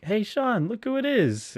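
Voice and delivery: high, loud mock call across the room; yelled greeting. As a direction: shout it up high like spotting an old friend. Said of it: "he yells out to Sean".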